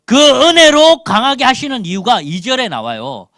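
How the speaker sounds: loud, high-pitched pulpit delivery; forceful preaching. Real, not acted. Preaches on a Bible verse.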